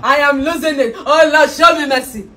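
high, loud mockery through laughter; laughing mockery. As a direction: laugh as you mimic him. Wife mocks him laughing.

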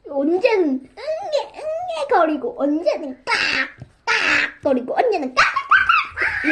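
high laughing crow caws; imitating baby and crow. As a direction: mimic a baby cry then crow caws. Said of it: "깍깍 거리는 건데".

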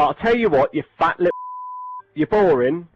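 ordinary talk

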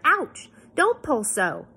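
high, loud ouch, then a complaint; sharp protest at a sudden hurt; pained yelp. A narrator's reading. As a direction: yelp as if your hair is yanked. Hair tugged sharply while brushed.

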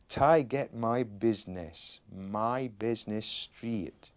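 put-on slow Finnish accent, t for d, "business street" for straight; Finnish-accented English. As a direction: read it slowly as a Finn singing English would. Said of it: "That sounds like, someone from Newcastle would say".

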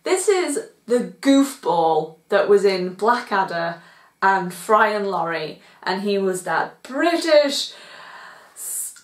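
ordinary talk, nothing marked

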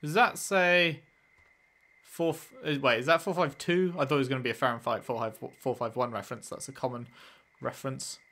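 ordinary talk

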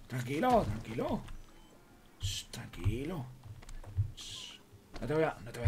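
calm the scared puppy down; soothing hush. Real, not acted. Calming a crying dog.

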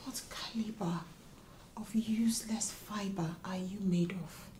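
low contemptuous whisper; hissed whisper. Acted. Wife to husband in the bedroom, cold whisper. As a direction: spit the words out in a cold whisper.

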